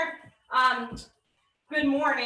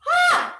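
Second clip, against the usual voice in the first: effort grunt; 6 dB louder than usual. Real, not acted. Effort at end of rep.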